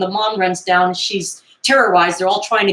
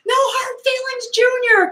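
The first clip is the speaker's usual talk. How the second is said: high yelled call; playful high shout; mock yell. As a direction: yell it after him, high and teasing. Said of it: "and Carrie yells".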